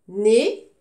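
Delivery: "Né" alone, loud and rising; teacher calling out the syllable "Né"; isolated syllable. Shown as a demonstration. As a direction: sound out "Né" as one loud beat. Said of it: "je découpe le mot".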